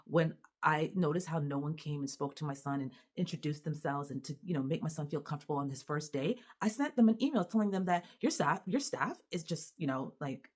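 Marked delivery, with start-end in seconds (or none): none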